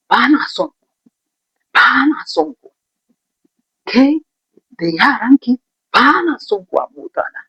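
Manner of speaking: flatly refuse, raising the voice; angry refusal. Retold or imitated. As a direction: driver rejecting the mother angrily. Driver refusing mother, shouting.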